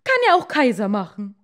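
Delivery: hammed-up raging wife, fast; furious shout. A narrator's reading. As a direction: scream at him like an enraged queen. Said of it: "furious queen wife".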